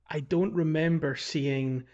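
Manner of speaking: ordinary talk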